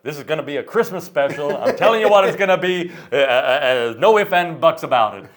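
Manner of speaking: high, loud overbearing decree through a laugh; Colonel's shout. Retold or imitated. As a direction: shout him down, no arguments. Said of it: "the Colonel's like".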